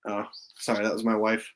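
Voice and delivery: hushed, soft talking; quiet at night. Real, not acted. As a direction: keep it down, parents asleep. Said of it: "so I gotta be kinda quiet".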